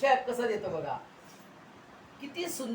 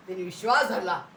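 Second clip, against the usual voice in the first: normal talking voice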